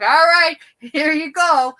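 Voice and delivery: high, booming mock send-off; loud jokey announcement. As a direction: call it out loud and high, imitating a joker. Cousin's joke at nursing home.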